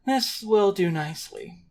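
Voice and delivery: lightly voiced smug stranger; a hint of a pleased gentleman; mysterious stranger voice. GM voices man in suit.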